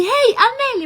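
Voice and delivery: high, loud and eager hello; a fan's loud, excited hail; excited greeting. Retold or imitated. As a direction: call out like you've spotted a celebrity. Strangers greet her in Marrakech.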